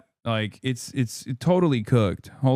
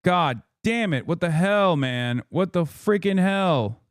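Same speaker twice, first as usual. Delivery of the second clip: high-pitched, exasperated cursing; worked-up, high exasperation; exasperated outburst; 6 semitones higher than usual. Real, not acted. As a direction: vent real frustration, pitch jumping up. Fix failed, venting at it.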